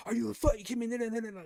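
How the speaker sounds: high, loud mock rant; retold pissed-off cussing. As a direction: imitate a friend cussing out in exasperation. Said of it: "You just cussing out, getting pissed".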